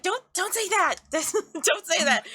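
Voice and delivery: high-pitched mock scolding; flustered mom scolding. Mom scolds her child.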